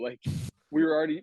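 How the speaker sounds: normal talking voice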